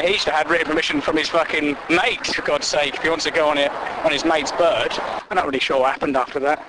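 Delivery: usual speaking voice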